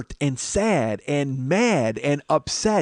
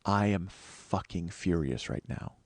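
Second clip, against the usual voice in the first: quiet fury; 6 semitones lower, 9 dB quieter, slower than usual. Retold or imitated. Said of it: "I look at her and I go".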